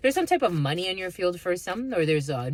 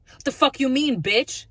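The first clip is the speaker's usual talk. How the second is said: higher, louder indignant snap; mock angry comeback; 7 semitones higher, 4 dB louder than usual. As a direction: throw it back like a guy who got turned down. Rejected guy snaps back.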